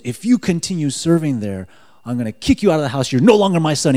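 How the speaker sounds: imitated parental anger, rising to loud; imitating angry dad. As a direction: act out a mad father, building in force. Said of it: "my dad got so mad at me".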